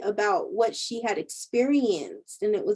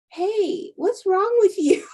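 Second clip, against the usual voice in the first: nosy friend imitated; 10 semitones higher, 5 dB louder, slower than usual. Nosy friend questions weird girl.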